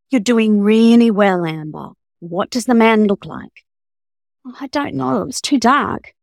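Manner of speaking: lively read-aloud with character voices; story narration. Acted. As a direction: read the thriller scene with mounting tension. Author reads own novel aloud.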